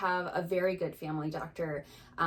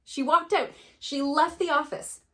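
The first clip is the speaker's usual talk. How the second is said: higher, louder, indignant disbelief; worked up; 8 semitones higher, 6 dB louder than usual. Real, not acted. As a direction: say it in real outrage, punching each phrase. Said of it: "I'm getting all worked up".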